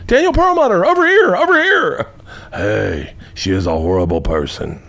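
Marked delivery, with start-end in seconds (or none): 0.1-2.1 high, loud paparazzi shouts; calling from far away. Pretend. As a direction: call out across the red carpet for his attention. Photographers calling on red carpet.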